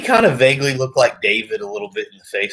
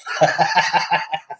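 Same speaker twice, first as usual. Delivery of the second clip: laugh; 8 semitones higher than usual. Real, not acted. Laughing at own joke.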